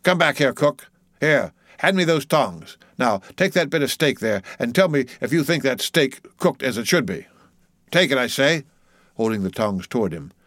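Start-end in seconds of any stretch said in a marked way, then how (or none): 0.0-8.6 lightly voiced bossy mate, pitched up; commanding mate. Mate making old cook taste steak, commanding. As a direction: order the cook back insistently.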